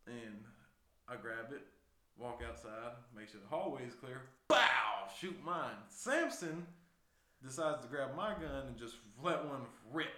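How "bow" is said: loud mouth-made gunshot pop; loud imitated bang; gunshot imitation. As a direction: blurt a gun blast. Imitating his own gunshot.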